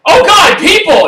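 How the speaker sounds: high, loud startled cry; panicked yelp. Acted. Cultist spots the party and yelps in panic. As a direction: yelp it out in alarm.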